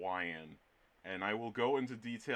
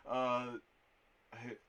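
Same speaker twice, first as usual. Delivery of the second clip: ordinary delivery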